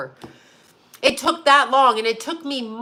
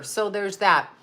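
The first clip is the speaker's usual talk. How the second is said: ordinary talk, nothing marked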